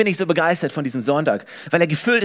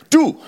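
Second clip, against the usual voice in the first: „Du.“ punched, higher and louder; stress on Du; 8 semitones higher, 6 dB louder than usual. Shown as a demonstration. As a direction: hit „Du.“ hard like a call-out. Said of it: "ich liebe diese Betonung". (betontes, lautes „Du.“)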